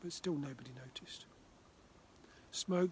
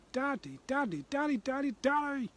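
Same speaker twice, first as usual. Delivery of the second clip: higher and louder pleading Daddy, building up; insistent calling; 10 semitones higher, 9 dB louder than usual. Acted. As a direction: nag him until he answers. Child pestering Dad insistently.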